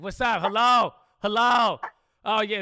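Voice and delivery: high, loud phone shouting; yelling on the phone. Retold or imitated. A host imitating his dad yelling into the phone, shouting high and loud. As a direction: bellow hello like a bad signal. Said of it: "yelling at the phone".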